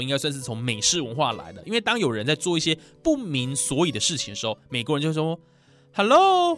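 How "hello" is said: high, loud, drawn-out hello; hello? as if baffled; puzzled American hello. Retold or imitated. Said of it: "来表示说很疑惑". (美式夸张疑惑语气)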